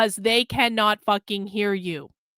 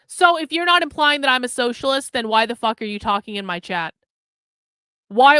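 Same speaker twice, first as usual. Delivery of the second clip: usual speaking voice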